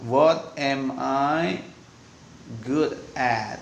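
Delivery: What am I good at, said very slowly word by word; very slow demo. Shown as a demonstration. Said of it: "Bahasanya begitu ya, harus pakai at". (ucapkan What am I good at pelan sekali)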